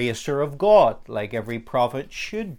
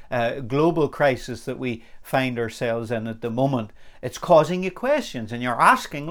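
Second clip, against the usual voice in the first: ordinary talk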